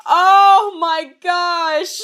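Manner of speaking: drawn-out, slow laughing cry. Real, not acted. Reader dying of laughter at the story, slow laughing cry. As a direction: stretch it out while laughing. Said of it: "laughing, stretched".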